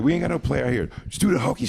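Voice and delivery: mild, high-pitched mock yelling; retold yelling. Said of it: "She kept yelling".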